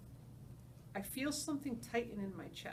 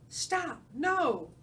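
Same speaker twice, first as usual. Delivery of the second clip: high, loud panicked cries from a nightmare; frantic cries; 7 semitones higher, 9 dB louder than usual. Acted. Baby elephant crying out in a nightmare, frantic. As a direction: scream out of a bad dream.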